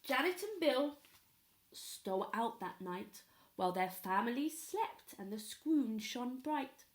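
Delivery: ordinary delivery, nothing marked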